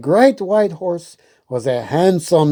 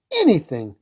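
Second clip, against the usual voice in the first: ordinary delivery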